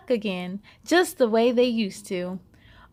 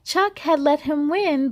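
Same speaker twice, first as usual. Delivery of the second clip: ordinary delivery, nothing marked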